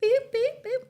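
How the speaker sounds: timer beeps. Pretend. Imitating a timer beeping.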